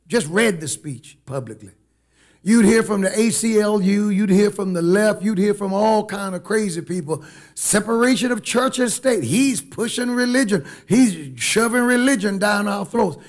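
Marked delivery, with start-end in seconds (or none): 2.4-7.2 higher, punchy sermon delivery; forceful preaching. Acted. Preacher warning church of the backlash, forceful preaching. Preaches against imagined critics. 7.5-13.2 higher-pitched imitation of protesting critics; mock outrage. Preacher voicing critics of the president's speech, mock outrage.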